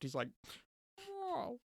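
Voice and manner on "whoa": high mock-sad falling whoa; a sorry little high yelp. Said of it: "he's just sad 'cause".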